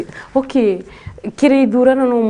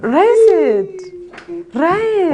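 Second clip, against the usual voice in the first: rising pitch; 9 semitones higher, much slower than usual. Shown as a demonstration. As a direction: push the word Rise up high, ending on a rise. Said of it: "Then rise that intonation".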